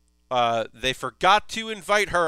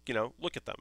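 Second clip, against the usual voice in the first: hushed request; 4 semitones lower, 7 dB quieter, faster than usual. Retold or imitated. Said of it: "he's like".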